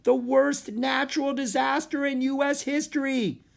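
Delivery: high, urgent shout to a crowd; yelled warning. A narrator's reading. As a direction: sound the alarm at full voice. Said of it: "he yelled".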